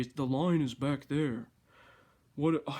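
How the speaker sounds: huffy, scolding pedestrian; indignant. Retold or imitated. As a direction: scold like a fussy stickler. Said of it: "to look indignantly at me".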